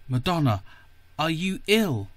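ordinary delivery

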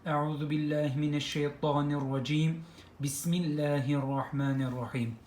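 quick, hushed Arabic recitation; quiet devout intoning; prayer recitation. Acted. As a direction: rattle off the opening prayer softly. Opening prayer before the lesson.

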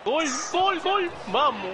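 breakneck goal commentary. Real, not acted. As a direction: yell gol in pure joy. Scores a goal while playing.

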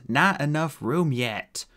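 irritable, drawn-out snap; snapping. A narrator's reading. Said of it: "snapped Holly".

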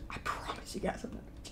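very soft, voiceless whisper; whispered denial. Real, not acted. Streamer assuring chat he is not mad, tiny whisper. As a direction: whisper the promise almost inaudibly.

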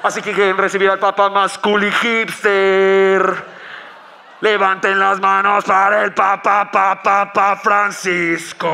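slow, drawn-out arena announcer calling the crowd; hype announcer. Pretend. Announcer hyping the crowd for the Pope's entrance, slow and booming. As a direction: stretch every word like a stadium MC.

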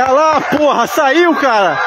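real excitement; thrilled. Real, not acted. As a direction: cry it out in amazement. Balloon released, thrilled.

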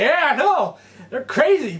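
high-pitched, laughing acted reply; amused agreement. Fisherman to his buddy about the big payers, amused.

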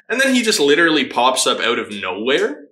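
ordinary talk

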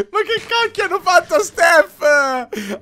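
high, tearful laughing speech; laughing to tears. Real, not acted. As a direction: laugh so hard it almost cries. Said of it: "mi viene da piangere".

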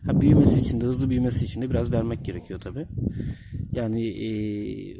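usual speaking voice

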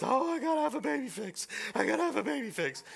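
high, sing-song mock of his wife's baby craving; imitating his wife. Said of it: "And then Amy's always".

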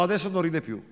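ordinary talk, nothing marked

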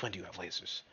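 unvoiced disbelieving whisper. Real, not acted. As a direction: mutter it to yourself in a whisper. Repeats the line whispered.

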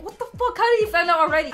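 a mock double-take, voice climbing; retold disbelief. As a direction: pitch jumps well up in disbelief.

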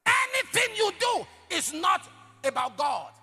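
high, loud preacher's cry; preaching shout. Real, not acted. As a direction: drive the warning home to the church. Preaching at full force.

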